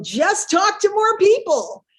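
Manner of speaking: high, slow mocking mentor voice; lilting sing-song put-down. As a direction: imitate a smug mentor dismissively. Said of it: "And they go".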